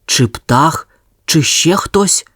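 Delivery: ordinary delivery, nothing marked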